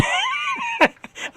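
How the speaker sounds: shrieking laugh. Real, not acted. Laughing at his own joke.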